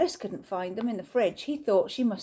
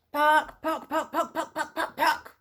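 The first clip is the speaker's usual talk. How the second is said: higher, laughing hen clucks; mock chicken clucking; 5 semitones higher than usual. Mrs Ellis joins chicken imitation.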